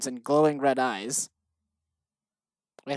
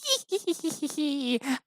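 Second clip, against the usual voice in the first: high villain cackle; evil laugh; 12 semitones higher, 5 dB quieter than usual. Pretend. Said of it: "I'll do my best evil laugh".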